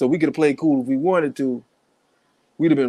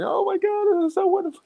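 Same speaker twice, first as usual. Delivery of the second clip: much higher, syrupy gushing lady voice. Pretend. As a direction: squeal it like a delighted church lady.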